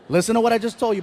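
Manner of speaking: usual speaking voice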